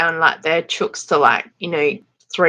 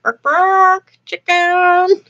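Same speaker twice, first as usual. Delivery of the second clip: much higher, silly barking call; mock chicken call; 11 semitones higher, much slower than usual. Pretending to hawk chickens in the store, high and silly. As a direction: bark the word like a goofy hawker. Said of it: "that's something I would do".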